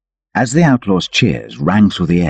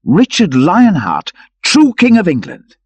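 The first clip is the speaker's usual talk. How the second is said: awed proclamation; 13 semitones higher, 5 dB louder than usual. Acted. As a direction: proclaim it in wonder. Robin hails the king.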